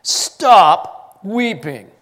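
slow, loud, drawn-out stern order; stern command. A narrator's reading. Elder sternly ordering weeping John, slow and loud. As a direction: command him firmly and slowly to stop. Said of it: "one of the elders said to me".

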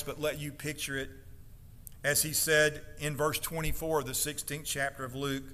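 ordinary talk

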